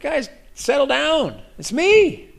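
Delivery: high-pitched, light calming call; playful reassurance. Acted. Jesus calming his scared disciples, playful high-pitched reassurance. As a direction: pitch it way up, coaxing.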